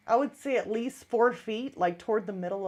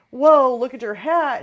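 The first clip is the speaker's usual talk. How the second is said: startled exclamation; 10 dB louder than usual. Retold or imitated. Daughter quoting mom's startled shout.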